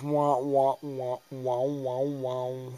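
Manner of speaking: repeated "wah" sound effect; wah-wah game noise; draining health-bar noise. Retold or imitated. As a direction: imitate a game meter sinking. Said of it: "my H P goes".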